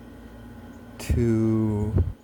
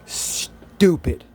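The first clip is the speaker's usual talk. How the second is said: exasperated outburst; 6 semitones higher, 7 dB louder than usual. Real, not acted. Exasperated rant about heroism.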